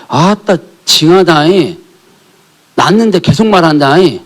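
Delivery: slow, drawn-out Jeolla dialect; a lightly voiced Jeolla accent quoting the Lord's complaint. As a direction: imitate a Jeolla speaker, slow and teasing. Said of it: "사투리 하시나 봐".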